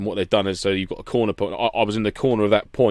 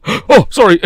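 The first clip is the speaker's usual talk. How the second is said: high, loud startled yelp of apology; startled mock apology; 11 semitones higher, 9 dB louder, slower than usual. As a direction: imitate someone jumping back apologetically. Retold startled apology over rifle.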